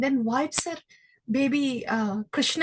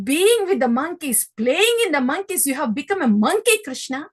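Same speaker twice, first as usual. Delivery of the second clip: louder, higher exasperated rebuke; scolding mother; 5 semitones higher, 6 dB louder than usual. Acted. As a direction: scold a naughty boy. Yashoda scolding Krishna.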